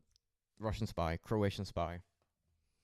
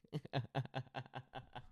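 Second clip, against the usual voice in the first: burst of laughter; 6 semitones higher, 6 dB quieter than usual. Real, not acted. Laughing at Vinny joke.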